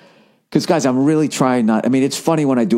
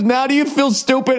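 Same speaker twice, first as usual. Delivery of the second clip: high, smug put-on taunt; smug driver; 12 semitones higher than usual. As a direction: gloat like a snotty rich guy, pitched up.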